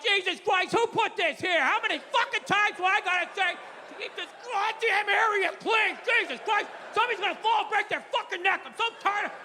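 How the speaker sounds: exasperated dad ranting at the mess; hammed-up furious father yelling about the clutter; mock angry rant. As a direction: rattle off a fed-up tirade, half-shouting.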